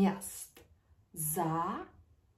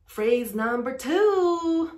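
normal talking voice

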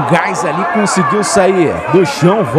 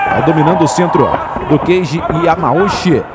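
normal talking voice

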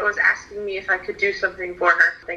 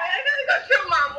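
excited cry; 11 semitones higher than usual. Real, not acted. Excited shouting while crying.

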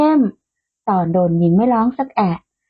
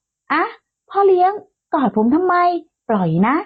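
startled protest; 7 semitones higher than usual. A narrator's reading. Grabbed into a hug, protesting.

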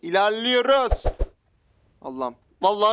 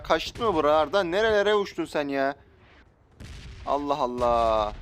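ordinary delivery

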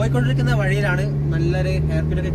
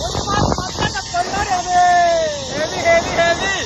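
high, loud, breathless thrill; thrilled shouting; 11 semitones higher, 3 dB louder than usual. Real, not acted. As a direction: shout it out mid-ride. Shouting from the zipline.

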